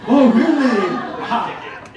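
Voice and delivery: loud, laughing mock surprise; surprised laugh. Real, not acted. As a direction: burst out laughing in teasing surprise. Teases the iPad expert.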